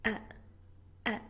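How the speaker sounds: uh-uh with brief glottal catches; isolated glottal stop demo. Shown as a demonstration. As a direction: cut the air off sharply at the throat. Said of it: "The glottal stop is made by the closure".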